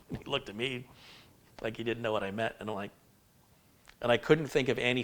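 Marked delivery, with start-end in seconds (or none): none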